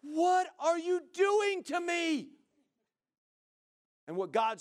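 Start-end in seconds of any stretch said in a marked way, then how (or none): 0.0-2.3 high, loud, slow complaining whine; mock-whine at God like a put-upon leader; hammed-up whiny plea; whining Moses. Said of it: "probably just goes".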